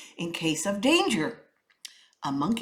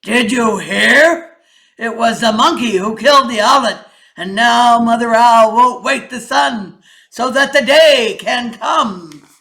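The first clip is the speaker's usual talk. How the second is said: King Lion; 3 semitones higher, 15 dB louder than usual. A narrator's reading. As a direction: boom it out like a king addressing his court. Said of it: "The king said to the gathered animals".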